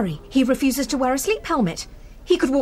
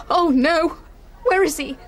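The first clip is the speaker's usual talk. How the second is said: alarmed; 6 semitones higher than usual. Acted. Alarming phone news.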